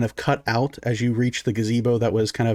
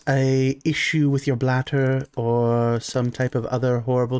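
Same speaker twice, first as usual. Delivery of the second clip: nosy priestess. Acted. Priestess probes the curse.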